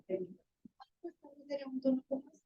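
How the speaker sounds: softly demonstrating a voice nobody hears; too-quiet voice. Shown as a demonstration. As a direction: drop to a weak murmur to show the wrong tone. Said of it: "Si yo les hablara".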